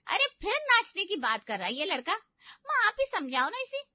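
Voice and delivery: high, loud exasperated complaint. Acted. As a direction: throw your hands up at him. Sister exasperated with brother.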